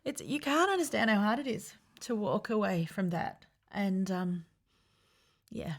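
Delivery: very soft, barely holding back tears; quiet and emotional, voice close to breaking; choked up. Real, not acted. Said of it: "It's really emotional".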